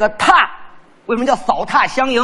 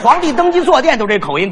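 ordinary talk, nothing marked

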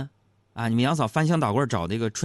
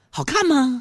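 high, loud imitation of an eager wife; wide-eyed wife; 6 dB louder, slower than usual. Wife trying on clothes asks husband, high wide-eyed voice. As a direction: ask it pitched way up, mimicking the wife. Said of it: "她都会瞪大眼睛问我".

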